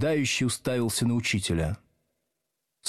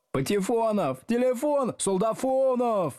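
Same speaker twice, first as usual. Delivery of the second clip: mocking corrections; 12 semitones higher than usual. A narrator's reading. Pupils mockingly correcting the teacher's roll call, high jeers. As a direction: sing out each silly name higher. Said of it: "поправляли Слушкина".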